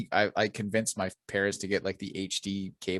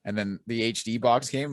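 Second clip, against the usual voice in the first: ordinary delivery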